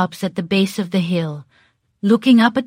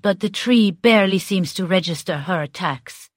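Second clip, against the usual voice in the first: normal talking voice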